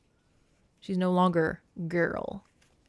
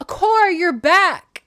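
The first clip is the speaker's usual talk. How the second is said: higher, louder and slow, smothering mom; clingy mother; 10 semitones higher, 10 dB louder, slower than usual. Retold or imitated. Demeter greeting her daughter home, gushing and smothering. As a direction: gush over the returning daughter. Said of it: "she's like".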